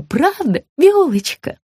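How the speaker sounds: high coaxing question; high sugary woman. A narrator's reading. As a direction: coax her sweetly. Margarita's voice.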